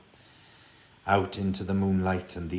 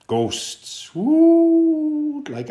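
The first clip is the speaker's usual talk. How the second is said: ghostly hoot; 9 dB louder, much slower than usual. A narrator's reading. Ghostly owl hoot in story.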